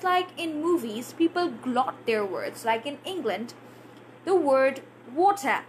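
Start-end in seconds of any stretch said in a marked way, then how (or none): 5.1-5.6 "water" with its T sounded; full T in water. Shown as a demonstration. Teacher shows learners the word before glotting, "water" with clear T. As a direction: say "water" with the T pronounced, not glotted. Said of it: "they glot their T sound".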